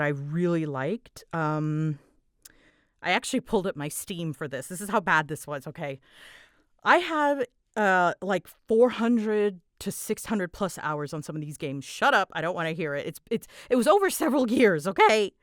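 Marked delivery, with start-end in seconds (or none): none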